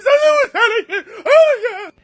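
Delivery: high, loud sobbing voice; ugly crying. Retold or imitated. To the police officer after the escape, a high loud sobbing wail. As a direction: blubber it out through tears. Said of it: "I'm ugly crying".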